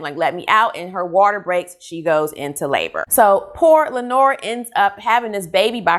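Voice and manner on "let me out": ordinary delivery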